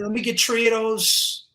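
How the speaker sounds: three said as "tree", run into "those"; "three" with a hard T, slurred together; Jersey "tree" for three. Retold or imitated. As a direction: imitate a Jersey dad saying "treeadose" for "three of those". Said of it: "would say tree ... You know how they do?".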